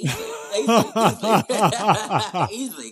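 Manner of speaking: amused, teasing giggle; laughing. Real, not acted. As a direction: laugh through the words. Teasing about James Bond role.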